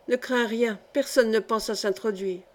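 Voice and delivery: ordinary delivery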